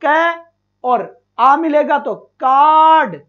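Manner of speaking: Card built slowly from क and आ; slow blending. Shown as a demonstration. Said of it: "क और आ मिलकर बनेगा का". (धीरे-धीरे ध्वनि जोड़कर)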